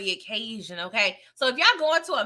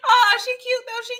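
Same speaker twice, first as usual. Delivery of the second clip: gushing coo; 12 semitones higher, 5 dB louder than usual. Real, not acted. Gushing over girl.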